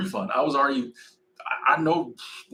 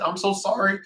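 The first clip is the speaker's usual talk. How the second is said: normal talking voice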